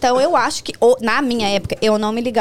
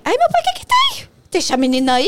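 high, hammed-up motherly squeal, a bit louder; mother imitated; 9 semitones higher, 4 dB louder than usual. Retelling the trip, she mimics her mother in a high squeal. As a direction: squeak it out like a fussing mom. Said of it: "E minha mãe".

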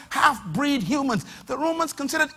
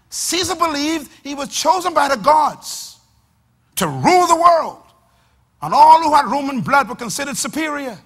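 preaching; 2 semitones lower, 6 dB louder than usual. Acted. Preaching at full force.

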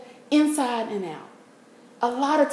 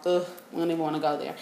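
quick, soft, dismissive groan; annoyed ugh; 5 semitones lower, 8 dB quieter, much faster than usual. Real, not acted. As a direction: brush it off fast under breath. Dismissing watching the news.

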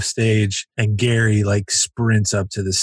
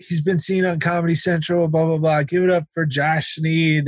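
raised-pitch emcee introduction; club host; 6 semitones higher than usual. Retold or imitated. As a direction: hype up the comic's credits. Host introduces comic to crowd.

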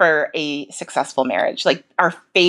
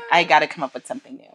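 ordinary delivery

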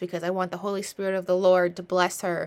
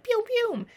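much higher playful pew pew; party pew sound. Retold or imitated. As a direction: squeak it out like a toy blaster. Imitating party shots.

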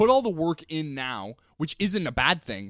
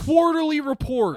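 angry boss; 11 semitones higher, 5 dB louder than usual. Pretend. Office boss barking demands.

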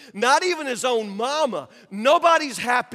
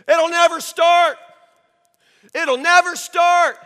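loud, high heckler's shout; full-voiced jeering yell; screamed heckle; 7 semitones higher, 12 dB louder than usual. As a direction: scream it out from the shore, drawing out the last word. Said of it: "a man on the shore who screamed".

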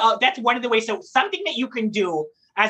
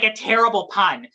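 normal talking voice

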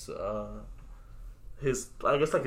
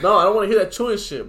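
louder, higher, fed up; loud complaint; 7 semitones higher, 10 dB louder than usual. Real, not acted. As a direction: complain loudly at a friend. Complaining about chewing noise.

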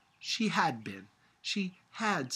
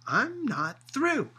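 very upset; 7 semitones higher, 8 dB louder than usual. A narrator's reading. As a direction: insist on finishing, upset. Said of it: "She was very upset".

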